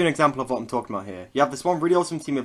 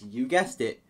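ordinary talk

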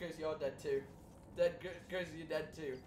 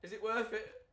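ordinary delivery

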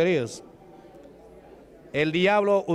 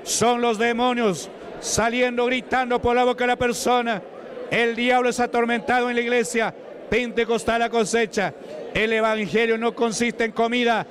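forceful, high-pitched revival preacher; full-force preaching; 5 semitones higher than usual. Real, not acted. Preacher warning listeners about screaming demons, full-force preaching.

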